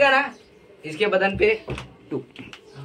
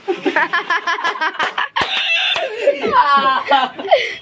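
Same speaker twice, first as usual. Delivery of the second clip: hammed-up drawn-out laughing fit; theatrical villain laugh; 9 semitones higher than usual. Exaggerated laugh opening inspector's take.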